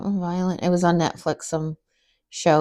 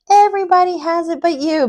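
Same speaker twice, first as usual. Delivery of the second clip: high, loud mock protest; hammed-up high-pitched insistence; loud woman imitation; 11 semitones higher, 6 dB louder than usual. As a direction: imitate a loud stranger objecting. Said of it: "she was already loud".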